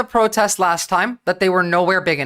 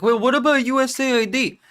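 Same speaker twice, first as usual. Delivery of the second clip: put-on De Niro accent; 5 semitones higher than usual. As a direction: play a dim heckler the De Niro way. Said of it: "I love her impression of an idiot".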